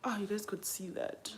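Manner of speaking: usual speaking voice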